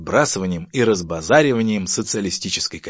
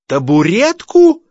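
very high, loud drawn-out squeal; 13 semitones higher, 13 dB louder, slower than usual. Acted. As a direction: squeal it in outraged disbelief. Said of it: "завизжал Семён Семёнович". (возмущённый визг)

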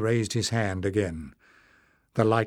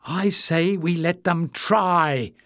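higher, slow call from a crowd; 6 semitones higher, 3 dB louder, slower than usual. A narrator's reading. Azan calling from the crowd, higher and slow. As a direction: project it slowly over a crowd. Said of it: "called Azan from the middle".

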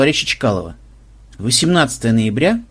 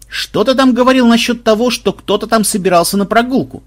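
ordinary delivery

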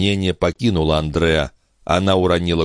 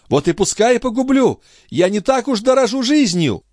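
very high, desperate retort; defiant outburst. Acted. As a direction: hurl back defiance, voice pitched way up. Mother defies brother's warning. (отчаянный, дерзкий ответ высоким голосом)

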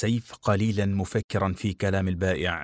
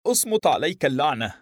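sharp, higher angry outburst; angry snap; 7 semitones higher, 3 dB louder than usual. A narrator's reading. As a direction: bark it out in irritation. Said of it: "فقال حانقا".

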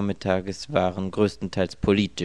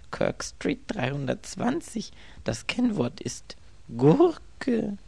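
lightly imitating the woman, higher and slow; mimicking her; 13 semitones higher, slower than usual. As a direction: echo her words, mock-whispery.

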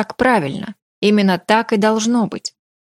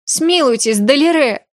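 higher-pitched plea for mercy; pleading; 6 semitones higher than usual. A narrator's reading. Future elf queen begging Далире for mercy, pleading. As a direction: beg for mercy, pitch lifted. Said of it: "взмолилась будущая королева эльфийских земель".

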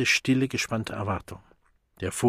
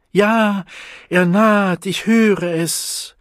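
high, loud cries, drawn out at the end; ecstatic screaming; 9 semitones higher, 8 dB louder, slower than usual. A narrator's reading. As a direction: shout it in religious frenzy. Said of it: "schrie Saroyini Engels".

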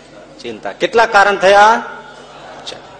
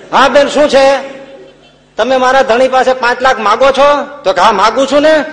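loud quarrel; 7 semitones higher, 7 dB louder than usual. Retold or imitated. Neighbours quarrel at the window.

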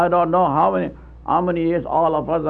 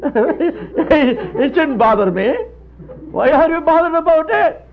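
laughing it off; 8 semitones higher than usual. Retold or imitated. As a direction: chuckle at the fuss, then tease back. Indian laughs off broken soup.

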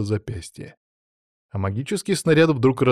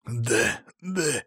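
soft, pained wheeze; hoarse croak; 6 dB quieter than usual. A narrator's reading. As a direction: croak it out through pain. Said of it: "прохрипел Алексей".

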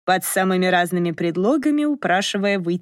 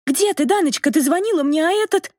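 high-pitched agitated phone outcry, rising higher; frantic wailing; 10 semitones higher than usual. A narrator's reading. Olya on the phone demanding where Dana is, frantic high wail. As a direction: wail it high, held short of a shout. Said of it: "Оля вопила так".

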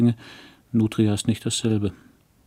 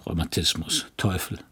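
scoffing; 3 semitones lower, 5 dB quieter than usual. A narrator's reading. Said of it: "sagt er".

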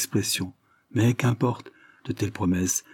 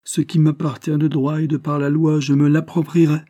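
higher, louder, unyielding reply; firm; 7 semitones higher, 5 dB louder than usual. A narrator's reading. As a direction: plant your feet and claim what's yours. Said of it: "répondit Carlyle avec fermeté".

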